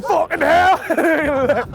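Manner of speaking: high, drawn-out cry through laughter; half-laughing panic at being caught; slow, high cry breaking into a laugh; laughing exclamation. Retold or imitated. Boys caught, retold panic.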